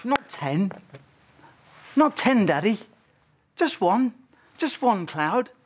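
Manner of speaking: put-on short phrases, a child gently insisting to dad; insistent young daughter. Daughter tells daddy she wants just one, lightly voiced insistence. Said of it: "then she would say".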